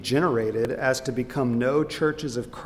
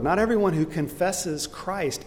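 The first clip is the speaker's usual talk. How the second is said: usual speaking voice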